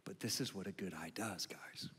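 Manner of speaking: hushed, breathy whisper to the room; a sudden whispered aside to the congregation. Real, not acted. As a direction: lean in and whisper it. Pastor drops into hushed aside.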